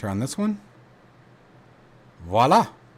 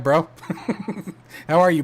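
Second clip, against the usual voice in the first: ordinary delivery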